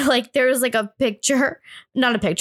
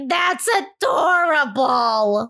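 put-on very slow, higher old-lady gush; mimicking old people; 5 semitones higher, much slower than usual. Kid copying old people at gift time, drawn-out gushing. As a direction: stretch every syllable like a doting old lady. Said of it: "mimicking the old people".

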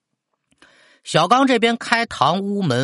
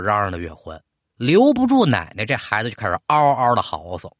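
ordinary delivery

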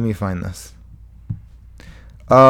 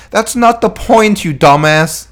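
exasperated retort; 8 semitones higher, 5 dB louder, slower than usual. Real, not acted. Snapping at critics.

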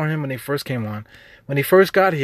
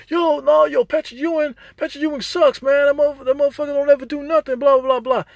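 much higher, loud, fast mock rant; mocking barbershop guy; 12 semitones higher, 4 dB louder than usual. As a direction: ham up a loudmouth trashing Ewing. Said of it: "somebody had to be like".

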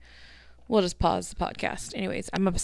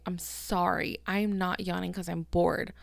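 ordinary talk, nothing marked

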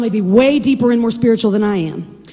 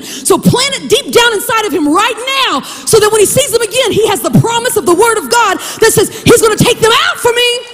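raised, driving preacher's intensity; urgent charge; 8 semitones higher than usual. Acted. God charging Moses about Joshua, urgent and rising. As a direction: press it home, pitched high and building.

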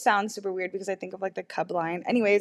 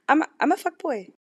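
mock confession; 6 semitones higher, 6 dB louder than usual. Real, not acted. Self-mocking loud confession.

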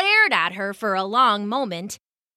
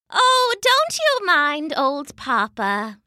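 higher, slow, soothing Mama voice; kindly Mama; 6 semitones higher, slower than usual. A narrator's reading. Mama comforts the animals after Papa's grumbling, soothing. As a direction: soothe them in Mama's high, slow voice.

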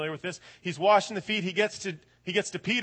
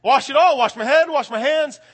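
higher, louder, eager pleading; overeager; 7 semitones higher, 11 dB louder than usual. Retold or imitated. Peter swinging the other way, loud and eager. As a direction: gush it out, all in. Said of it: "Peter then swings all the way".

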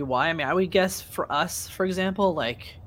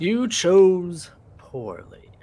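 slow, grave, louder pronouncement; solemn movie quote; 5 semitones higher, 7 dB louder, much slower than usual. Retold or imitated. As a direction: intone it like an ancient guardian. Quoting a movie line, solemn.

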